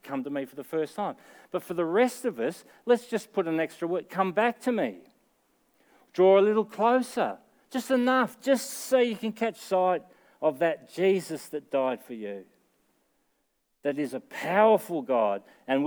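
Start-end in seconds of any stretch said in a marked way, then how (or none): 1.5-12.5 full-force preaching. Acted. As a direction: preach the invitation hard, with rising fervour.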